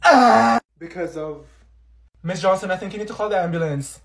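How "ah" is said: short loud cry of pain; pained yell. Acted. Olivia yelling in pain during her fit. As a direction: yelp out loud as it hurts.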